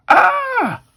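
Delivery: high falsetto scream; very high acted shriek; mock scream. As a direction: let out a hammed-up burning scream. Burning victim screams.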